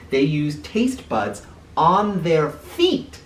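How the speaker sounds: ordinary delivery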